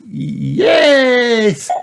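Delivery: high, loud, long yes; excited cry. A narrator's reading. Peppa loves Grandpa's idea, high excited cry. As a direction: cry out in a delighted child voice. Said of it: "cried Peppa".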